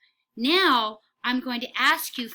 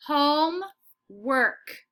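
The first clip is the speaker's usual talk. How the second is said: Homework stretched very slowly, rising higher; drawn-out model word; 4 semitones higher, 4 dB louder, much slower than usual. Shown as a demonstration. Teacher modelling for kids, very slow stretch. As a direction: slowly pull Homework apart for the class. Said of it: "teacher stretches word for class".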